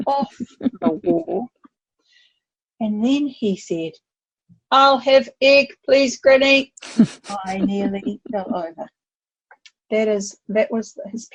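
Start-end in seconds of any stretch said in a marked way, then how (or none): 4.7-6.7 a hint of a robot, higher and louder; boy answers granny in robot voice; child's robot voice. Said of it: "we're gonna talk like a robot".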